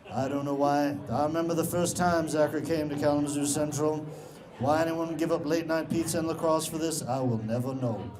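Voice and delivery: low, measured film-narrator voice; Morgan Freeman. Acted. Film narrator voicing a new student's thoughts, deep solemn impression. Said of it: "I did hear Morgan Freeman narrating my thoughts".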